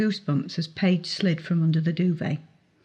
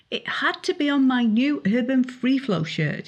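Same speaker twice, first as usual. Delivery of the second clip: complaining; 7 semitones higher than usual. A narrator's reading. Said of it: "he complained".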